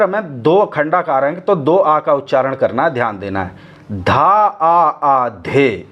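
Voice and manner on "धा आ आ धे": very slow, each syllable stretched with a long आ; "धा आ आ धे" recited very slowly, with both आ sounds held long; drawn-out recitation. Shown as a demonstration. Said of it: "इस प्रकार से पढ़ना है इसको".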